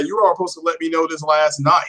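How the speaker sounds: ordinary delivery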